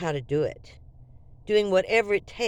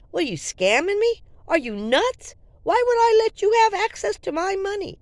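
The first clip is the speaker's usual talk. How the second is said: angry outburst; 9 semitones higher, 5 dB louder than usual. Retold or imitated. Scolding the scamming drifter.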